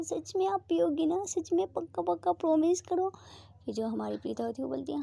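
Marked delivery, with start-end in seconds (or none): 0.0-3.1 high, excited delight; overjoyed girlfriend checking the promise. Acted. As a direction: squeal with happiness. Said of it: "बहुत ज़्यादा खुश हो जाती है".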